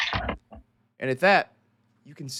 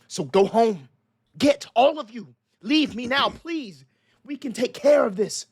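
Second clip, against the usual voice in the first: raised, firm plea to the crowd; constable pleading; 6 semitones higher, 4 dB louder than usual. Acted. Constable holding his hands up to the angry mob, firm raised plea. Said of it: "the constable holds his hands up".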